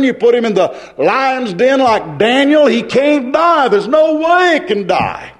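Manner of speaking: rising, driving pulpit cadence to a high peak; full-force preaching. Acted. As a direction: hammer it home with mounting fervour.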